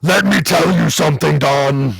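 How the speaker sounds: imitated governor lecturing a rival; mock debate challenge in a politician's voice; Jesse impression. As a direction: put on the ex-governor's odd voice. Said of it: "he has a very interesting voice".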